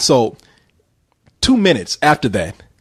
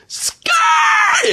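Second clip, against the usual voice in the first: very high shouted screech of tires. Retold or imitated. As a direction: imitate a car peeling away. Car imitated screeching off.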